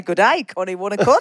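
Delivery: put-on Australian greeting; mock Australian accent. Greeting Kenny who is not Australian, mock Australian accent. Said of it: "he's not Australian, but I was going".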